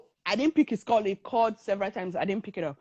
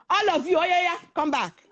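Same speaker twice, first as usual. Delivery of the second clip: higher-pitched summons; calling back; 6 semitones higher than usual. Retold or imitated. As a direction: imitate a man ordering a crowd back. Man calls the thugs back.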